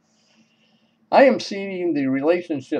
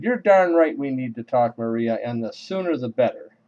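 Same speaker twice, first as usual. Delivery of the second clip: emphatic retort. Retold or imitated. John answering Maria's need to talk, emphatic retort. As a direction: throw it straight back, decisively. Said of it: "rocked her back on her heels when I said".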